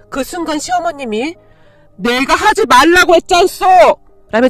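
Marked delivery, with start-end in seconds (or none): 2.0-3.7 loud, higher-pitched angry bellow; mother-in-law's furious yell; house-shaking shout. A narrator's reading. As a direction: roar at her to stop. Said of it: "소리를 지르시더니".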